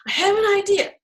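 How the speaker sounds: high, eager exclamation; excited. Acted. Narrator telling Rory of an idea, excited.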